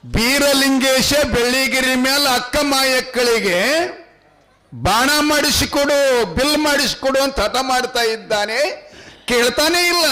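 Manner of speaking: high, ringing folk-tale recitation; high-pitched, sustained narrator's chant; declamatory storytelling. A narrator's reading. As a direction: declaim it high, stretching the phrase endings.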